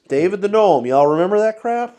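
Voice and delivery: loud, high nostalgic shout; excited outburst. Real, not acted. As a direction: blurt it out with glee.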